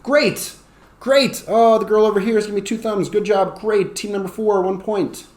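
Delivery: high, lively praise and point-giving; excited teacher. Shown as a demonstration. Teacher praises girl with two thumbs, high and excited. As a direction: cheer on the class game. Acts out awarding a student point.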